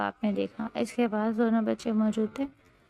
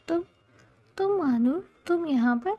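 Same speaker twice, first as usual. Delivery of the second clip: stunned disbelief; 7 semitones higher than usual. A narrator's reading. Husband recognising his hidden wife, stunned and high. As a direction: blurt it out as if seeing a ghost. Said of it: "زاویہ کی آواز حلق میں پھنس گئی".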